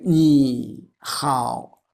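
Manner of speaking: 你好 slow, each syllable staying low; correct low tones. Shown as a demonstration. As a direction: say 你, then 好, with no upswing. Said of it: "We are using this sound".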